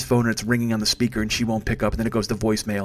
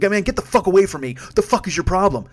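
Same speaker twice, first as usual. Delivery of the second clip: angry yell, pitched up; retold angry yell; 6 semitones higher than usual. Comedian retelling shouting at his drunk friend, angry yell. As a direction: snap at him to back off.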